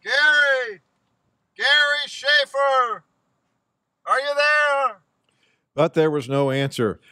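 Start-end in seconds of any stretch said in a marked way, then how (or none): 0.0-5.0 calling from far away. Real, not acted. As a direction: call his name at full voice. Reporter calls for missing man.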